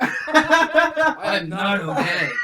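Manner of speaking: shouting through laughter; laugh-yell it, can't hold it. Real, not acted. Laughing hard after the spray.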